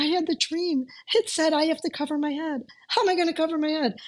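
high, anxious rush of words; excited worry. Retold or imitated. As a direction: pour it out, thrilled and panicked. Telling friend about the dream.

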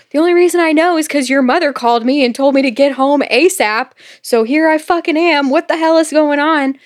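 rushed, worked-up retold complaint; exasperated retelling. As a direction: act out a fed-up boyfriend demanding answers. Exasperated boyfriend in retold argument.

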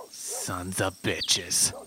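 slow, low, drawn-out disgust. Acted. [name] watching trail footage, slow low disgusted curse. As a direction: drag it out in disgust. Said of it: "[name] cries out with disgust".

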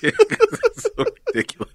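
laughing it out high and breathless; barely getting words through the giggles. Real, not acted. Telling the cohost about a silly clip, laughing hard.

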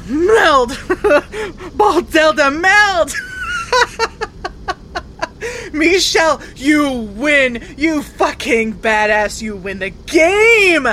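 high, loud cries broken by laughter; elated shouting. Real, not acted. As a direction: yell with joy as you win. Found missing friend, elated.

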